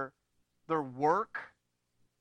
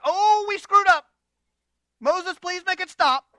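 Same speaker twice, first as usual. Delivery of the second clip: much higher and louder, begging in a panic; panicked pleading; 10 semitones higher, 13 dB louder than usual. Retold or imitated. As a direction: cry out for mercy, hammed-up. Israelites begging Moses to stop.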